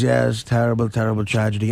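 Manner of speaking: put-on low, solemn German accent. German guide mourning on every tour, low solemn German accent. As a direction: play a German guide dutifully solemn, voice dropped. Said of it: "that German accent is just really".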